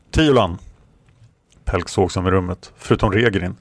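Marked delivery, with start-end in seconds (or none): 0.1-0.6 calling out. Acted. Farmer calls out alarmed.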